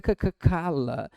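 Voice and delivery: c, c, cala slowly, the hard c clicked out; cala, tap out the hard c first; hard c repeated before cala; sounding out c. Shown as a demonstration. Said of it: "Agora é c".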